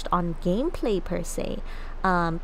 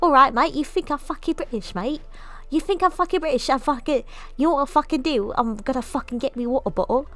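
mock British lout, threatening; rough-talking British "innit, mate" accent. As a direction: play a hammed-up British hooligan picking a fight. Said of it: "You're the best British fox out there".

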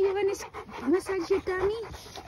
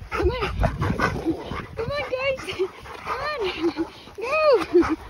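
high-pitched, urging call; rallying dog-walk call; 7 semitones higher, slower than usual. Real, not acted. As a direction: coax the dogs along in a higher voice. Calling the dogs along on a walk.

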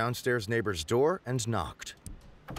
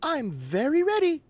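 higher, eager and sweet; bright guest voice. Acted. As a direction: say it with a winning smile. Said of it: "with a winning smile".

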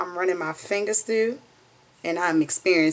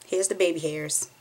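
ordinary talk

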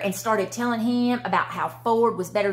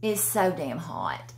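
ordinary talk, nothing marked

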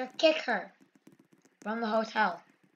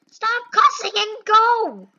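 high-pitched, fed-up order; exasperated scolding; 7 semitones higher than usual. Real, not acted. Gamer to cussing player holding up the line, exasperated scolding. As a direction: scold them in one exasperated run.

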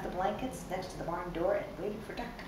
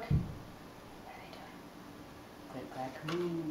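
usual speaking voice

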